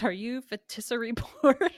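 "Fetisserie" in place of Felicity, rising question; mispronounced name. Retold or imitated. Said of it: "the name Felicity is that hard".

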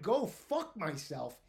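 slow, drawn-out indignant repeat; retold outrage, every word stretched; incredulous echo. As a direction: throw the insult back in disbelief.

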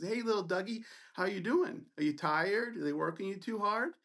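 mocking. Resident taunting a student in front of his group, mocking. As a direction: needle him with mock concern. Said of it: "continues to mock me".

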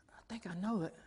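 hushed, hesitant recognition; puzzled half-whisper. Retold or imitated. Pastor trying to place the woman in the foyer, puzzled half-whisper. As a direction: wonder under your breath.

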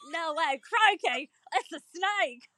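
high, hammed-up Australian exclaiming; mock Australian accent. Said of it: "Do I sound pretty Australian?".

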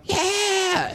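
high mock "Yeah!" to the crowd; hammed-up frontman cheer; arena singer hyping fans; rock star yell. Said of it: "And then they go".

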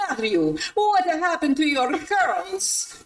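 put-on slow, high little boy with an Italian accent; mock Italian accent. Daycare boy asking Matthew about his haircut, in a mock Italian accent. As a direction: drawl the question like a curious Italian kid. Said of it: "he goes".

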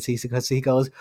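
usual speaking voice

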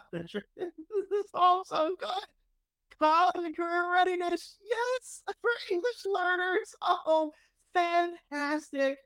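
high, slow, swooning praise; gushing delight. Real, not acted. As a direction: gush over every item like a delighted fan. Gushing over bill list.

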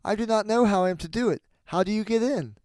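put-on high little-girl voice; Gretel playing innocent. A narrator's reading. Gretel stalls the witch at the oven, high innocent asking. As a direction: ask it high, as if puzzled. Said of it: "But Gretel saw what she had in her mind".

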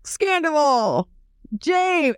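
imitating Sandoval with the mallet; mock angry naming. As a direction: hammer out each name. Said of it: "He's like".

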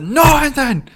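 high loud yelled reply; shouted reply. Retold or imitated. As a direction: yell it back at the drive-thru. He yells back at the intercom.

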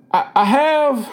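halting, high-pitched confession; struggling to say it. A narrator's reading. Boy struggling to tell the doctor he prayed. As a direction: stumble on the first word, then push on. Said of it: "it was hard".

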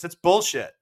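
mock angry stranger. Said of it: "and the guy goes".

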